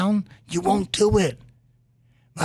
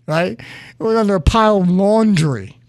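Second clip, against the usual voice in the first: usual speaking voice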